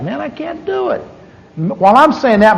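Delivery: ordinary talk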